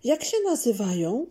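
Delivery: put-on high, eager child's voice, slowing on the flowers; a small girl delighting in spring flowers; excited little girl. A narrator's reading. Zuzia showing her family flowers, high and eager. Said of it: "krzyknęła Zuzia".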